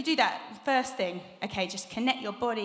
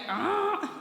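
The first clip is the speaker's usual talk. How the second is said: a quick high "Ah" pushed from the belly; sharp high diaphragm "Ah"; 10 semitones higher than usual. Shown as a demonstration. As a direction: pop the sound out from the tummy. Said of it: "Did you feel something go".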